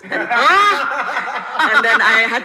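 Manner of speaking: laughing loudly through the punchline. Real, not acted. Actor tells the panel a joke, laughing loudly. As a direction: say it while cracking up. Joke about audience comment.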